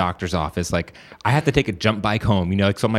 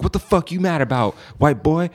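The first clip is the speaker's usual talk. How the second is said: higher-pitched mock-angry challenge; imitated anger; 7 semitones higher than usual. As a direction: act out an irate stranger confronting you. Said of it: "then he starts getting pissed".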